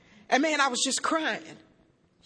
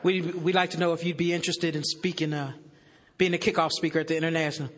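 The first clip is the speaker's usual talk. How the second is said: lightly voiced caller, lower and hesitant; a hint of a stiff official, lower; formal caller; 8 semitones lower, 4 dB quieter than usual. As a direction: play the polite, stiff official on the phone. Voicing the formal World Services caller.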